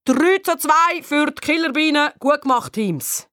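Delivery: high announcing call of the final score; teacher calling to both teams. A narrator's reading. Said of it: "ruft der Turnlehrer".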